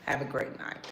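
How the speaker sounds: very soft, low, half-whispered thanks; airy brush-off. Retold or imitated. To a man on the rooftop, a soft whispered brush-off. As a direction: brush him off softly. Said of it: "And I was like".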